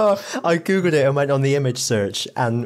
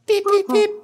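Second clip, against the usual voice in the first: high, louder imitated beeping; 4 dB louder than usual. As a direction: beep like a tiny machine, very high. Imitating a Pokemon cry.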